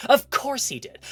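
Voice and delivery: a hammed-up exasperated retort. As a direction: snap it back in exasperation. Same line redone, exasperated wife.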